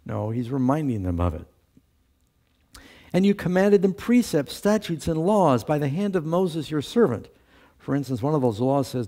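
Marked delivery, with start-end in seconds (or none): 3.1-7.3 scripture reading. Acted. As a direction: proclaim the verses, pitched up. Reads Nehemiah aloud.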